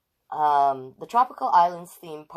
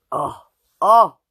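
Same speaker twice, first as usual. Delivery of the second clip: sudden excited oh; 3 semitones higher, 13 dB louder than usual. Real, not acted. Excited by a picture.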